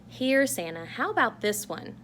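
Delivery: a hint of cheerful Mrs. Claus. Wife offering Santa a knitted cap, lightly voiced. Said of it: "said Missus Claus".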